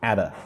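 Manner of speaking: "At a" joined as atta; put together. Shown as a demonstration. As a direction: say "At a" as one word, "a" as uh. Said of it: "put at and a together".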